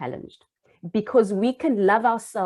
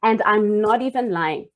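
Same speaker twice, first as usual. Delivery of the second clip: ordinary talk, nothing marked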